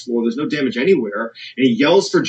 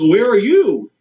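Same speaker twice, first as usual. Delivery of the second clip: higher, louder urgent demand; urgent question; 7 semitones higher, 4 dB louder, slower than usual. Retold or imitated. Kevin demanding to know where Jane is, urgent and louder. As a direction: press her for where she is.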